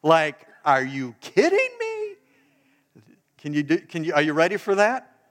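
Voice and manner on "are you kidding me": much higher, disbelieving cry; incredulous. Shown as a demonstration. Pastor showing the crowd its incredulous voice, high and disbelieving. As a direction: demand it like you can't believe your ears. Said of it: "practice your incredulous voice".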